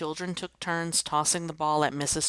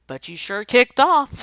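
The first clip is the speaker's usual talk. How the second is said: much higher, much louder teasing cry; excited tease; 9 semitones higher, 14 dB louder than usual. Acted. Joey teases the teacher, loud and excited. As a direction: crow it out, delighted.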